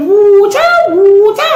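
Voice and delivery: "water" high and loud with a long oo; mock the put-on "water", stretched like "wooter"; affected "water". Auntie repeating the granddaughter's word, mocking affected "water". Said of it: "discussing a fake accent".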